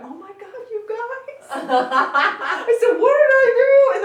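high, loud, helpless laughter through words; laughing while pleading innocence; breaking up laughing. Retold or imitated. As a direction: crack up mid-show. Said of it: "I literally broke in the middle of the show".

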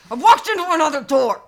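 loud, high yell of disbelief; exclaimed punchline. Retold or imitated. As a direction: shout the punchline out.

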